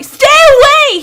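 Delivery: extremely high, loud shriek. Acted. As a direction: shriek it in terror. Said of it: "he shrieks".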